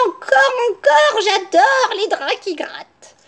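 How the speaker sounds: very high, gushing granny; delighted cry. A narrator's reading. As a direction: squeal with exaggerated pleasure. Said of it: "sa grand-mère qui s'écria".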